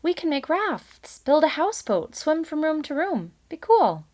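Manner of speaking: put-on a kid selling the fun of a flood; excited child. A narrator's reading. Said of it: "said a third".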